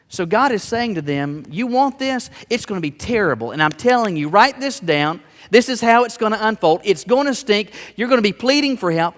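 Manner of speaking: loud, high, driving sermon warning; preaching at full force. Acted. Preacher warning the people about their king, at full force. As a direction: thunder the warning at the congregation.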